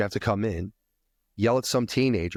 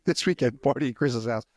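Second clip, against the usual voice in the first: school announcement voice; 4 semitones higher than usual. Retold or imitated. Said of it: "when I announced the party".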